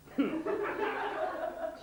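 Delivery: high unvoiced giggle at his own joke; breathy giggle. Real, not acted. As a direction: giggle through it, almost silent. Teller laughs at own joke.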